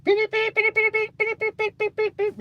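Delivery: very high run of beeps; imitated beeper button. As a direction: beep rapidly in a tiny high squeak. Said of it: "just get a beeper button".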